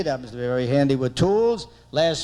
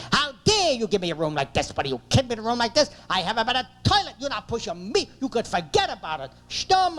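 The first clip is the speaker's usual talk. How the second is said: outraged pitched-up harangue; screaming wife; 4 semitones higher than usual. Retold or imitated. Wife to hotel clerk about the room, screaming. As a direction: imitate a wife tearing into a clerk, ending on a high screech. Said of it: "She starts screaming".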